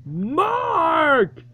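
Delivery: high wailing cry of Mark; frustrated tearful yell; crying shout. Real, not acted. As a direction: wail his name nearly in tears. Upset call, crying shout.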